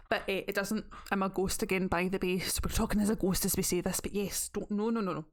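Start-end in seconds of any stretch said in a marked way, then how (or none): none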